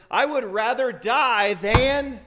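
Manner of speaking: a passionate debater cutting himself short; worked-up declaration that stops mid-thought; fiery outburst. Retold or imitated. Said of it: "He's getting all worked up".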